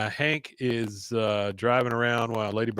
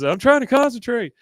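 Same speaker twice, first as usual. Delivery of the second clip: high, loud mock exasperation; imitated flustered irritation; exasperated; 11 semitones higher, 7 dB louder than usual. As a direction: snap at the crowd to leave you be. Voicing harried Hank.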